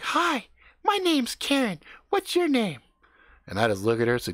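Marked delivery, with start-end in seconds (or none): 0.0-2.8 put-on high, slow, sing-song girl voice; mimicking a girl's affected high chatter; Karen's put-on voice. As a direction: imitate her fake interesting way of talking. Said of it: "really just kinda change her speaking pattern".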